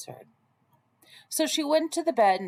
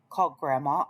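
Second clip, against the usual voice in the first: ordinary delivery, nothing marked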